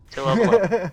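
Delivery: high, loud mock frustration; exaggerated exclamation. Shown as a demonstration. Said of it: "I mean, gosh darn it".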